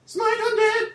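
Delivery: high, drawn-out mock incantation; echoed battle cry. Player echoes the cleric's spell, chanting high.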